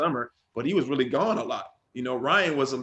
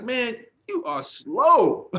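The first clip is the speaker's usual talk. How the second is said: slow, drawn-out teasing, a bit louder; mock slow taunt; 11 semitones higher, 5 dB louder, much slower than usual. Big brother teasing after winning the race, slow mocking drawl. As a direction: stretch it out to rub it in. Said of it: "he's like".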